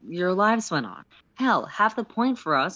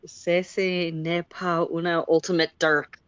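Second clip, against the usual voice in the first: slow, spiteful bad French; mock French accent, spiteful; spiteful mangled French; slower than usual. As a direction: sneer it in clumsy French. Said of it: "they say spitefully in mangled French".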